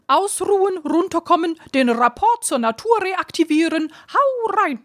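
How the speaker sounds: high, cheeky bird voice calling out; a cheeky raven caws its advice. A narrator's reading. Cheeky raven calling advice to the others. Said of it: "rief einer der rotzfrechen Raben".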